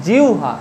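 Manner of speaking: জিহ্বা said as jiu-ha; correct pronunciation demo. Shown as a demonstration. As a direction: glide from i to u in জিহ্বা, then ha. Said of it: "এর উচ্চারণ করবে". (জিহ্বা-র উচ্চারণ "জিউহা")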